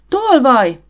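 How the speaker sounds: loud, high accusing yell; shriek. A narrator's reading. Harriet catches a thief at her drawer, shrieking. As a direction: cry it out at full alarm. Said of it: "süvöltötte".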